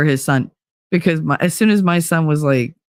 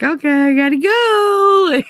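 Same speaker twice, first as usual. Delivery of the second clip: much higher, slow and drawn out; sleepy sign-off; 12 semitones higher, slower than usual. Retold or imitated. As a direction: sing it out as you drift off. Suddenly crashing asleep at three.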